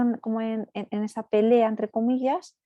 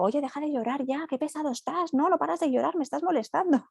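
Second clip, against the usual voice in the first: mock annoyed parent; 6 semitones higher than usual. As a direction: tease the baby as a fed-up parent, higher up. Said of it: "yo a un bebé no le digo".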